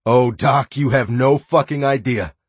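high, loud mocking relish; taunting sneer. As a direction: sneer it with dark glee. Taunting his captive.

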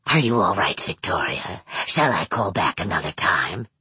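deep, measured villain voice; Manx's low voice. A narrator's reading. Manx on the phone.

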